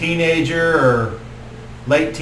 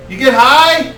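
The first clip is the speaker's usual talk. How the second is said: high, loud stoner question; stoner druid; 11 semitones higher, 8 dB louder than usual. Acted. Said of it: "He's like".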